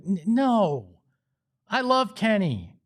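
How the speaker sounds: usual speaking voice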